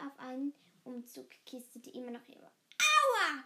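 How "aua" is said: high, loud "Aua" shriek; sharp pained scream. A narrator's reading. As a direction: yelp after stubbing a foot. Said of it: "kreischte sie".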